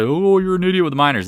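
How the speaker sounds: high, loud mock-complainer voice; mocking critic. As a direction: imitate a jeering online critic. Mimicking critics' direct messages.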